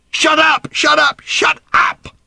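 high, loud, repeated furious scream; screaming shut up. Acted. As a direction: scream him down. Daughter yelling at her father.

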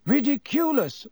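a class of pupils echoing the teacher; class in chorus. A narrator's reading. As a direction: pupils repeating the charm in unison. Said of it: "said the class together".

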